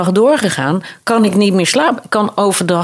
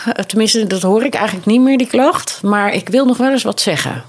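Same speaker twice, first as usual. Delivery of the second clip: usual speaking voice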